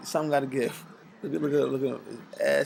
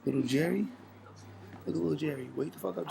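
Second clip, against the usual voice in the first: ordinary talk, nothing marked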